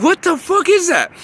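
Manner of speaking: very high-pitched exasperated cry; exasperated outburst. Retold or imitated. Speaker answering the voices in their head, high exasperated outburst. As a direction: pitch shoots way up in disbelief.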